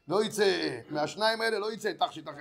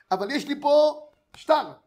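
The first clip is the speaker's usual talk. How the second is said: taunting; 6 semitones higher, 9 dB louder, slower than usual. Acted. Lender taunting the widow.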